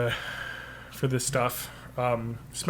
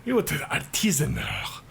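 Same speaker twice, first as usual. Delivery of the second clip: mock Italian accent; 3 dB quieter than usual. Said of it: "That's my Italian accent".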